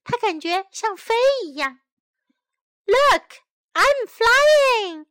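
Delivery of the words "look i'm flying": high, loud happy shout; shouting with joy. Acted. May running down the hill, loud joyful shout. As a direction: call it out while running downhill. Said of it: "May shouts".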